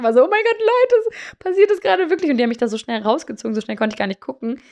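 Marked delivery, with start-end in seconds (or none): 0.2-2.3 high, shocked cry of disbelief. Retold or imitated. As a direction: squeal it out like you can't believe you're stuck. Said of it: "ich war so geschockt". (hoch und geschockt, fassungslos)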